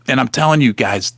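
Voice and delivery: ordinary delivery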